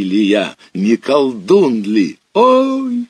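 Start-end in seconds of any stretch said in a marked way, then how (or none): 2.3-3.1 sudden cry, much higher and louder; sharp cry. Acted. Boy hooked by the line, sharp yelp of pain. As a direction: yelp as a hook bites the hand. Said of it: "вскрикнул Санька".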